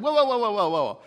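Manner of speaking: loud, high rush of protest; acted alarm. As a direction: halt the angel in a mock panic. Ghost alarmed at lizard being killed.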